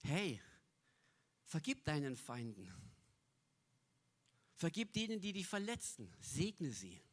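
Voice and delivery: Jesus gently urging forgiveness; hushed merciful voice; gentle Jesus. Pretend. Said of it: "Jesus kommt und sagt".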